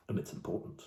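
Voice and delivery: whispered aside, unvoiced and soft; hushed confiding whisper. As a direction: let it out as a secret under the breath. A confiding aside after the joke.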